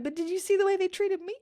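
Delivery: high, whiny, self-justifying; arguing back with the Holy Spirit, high and whiny; indignant protest. Retold or imitated. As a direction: plead your case to God like a wronged kid. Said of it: "in my head, I was like".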